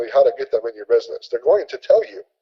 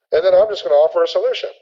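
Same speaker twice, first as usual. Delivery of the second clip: usual speaking voice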